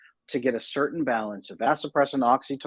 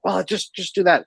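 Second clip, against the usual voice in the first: raised, mildly exasperated retort; snapping at kid; 7 semitones higher, 4 dB louder than usual. Retold or imitated. Dad on a stupid day to his kid, mock snapping. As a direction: imitate an impatient parent, pitched up. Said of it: "I snapped at my kid".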